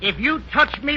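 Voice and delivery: usual speaking voice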